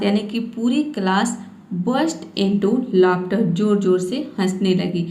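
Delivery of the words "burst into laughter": slow, careful English reading. Shown as a demonstration. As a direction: spell it out slowly for learners. (धीमे स्वर में पढ़ना)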